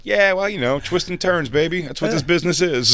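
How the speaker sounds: high, easygoing mock voice; breezy con-man shrug; imitating Patrice. Patrice explaining the business, high and breezy. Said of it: "He's like".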